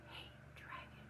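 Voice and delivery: very soft unvoiced whisper. Princess testing if the dragon sleeps, soft whisper. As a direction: breathe it into the quiet. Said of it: "Elizabeth whispered very softly".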